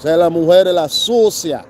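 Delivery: loud put-on broken Spanish; mock Spanish catcall. Mocking a tourist catcalling in broken Spanish.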